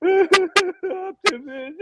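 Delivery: high, drawn-out mock wail of the name; mock sob. Kid in the theater wailing over Optimus, mock sob.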